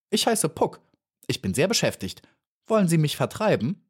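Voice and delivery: self-important little fly, lightly acted; busy, brusque fly. A narrator's reading. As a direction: play a busy little fly, a touch indignant. Voices the fly Puck.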